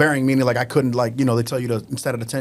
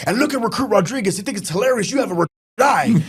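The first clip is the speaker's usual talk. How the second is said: mocking drill instructor; 7 semitones higher than usual.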